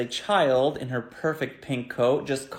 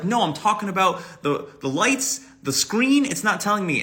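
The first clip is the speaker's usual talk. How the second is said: higher, louder explaining; frustrated passenger; 6 semitones higher, 4 dB louder than usual. Retold or imitated. Passenger getting through to the bus driver. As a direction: insist with rising frustration.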